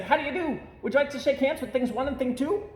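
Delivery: high, quick, bubbly greeting; two eager little creatures saying hello; lightly voiced playful Things; excited Things. A narrator's reading. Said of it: "They said".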